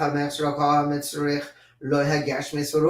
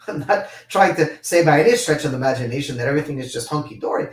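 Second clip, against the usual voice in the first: ordinary delivery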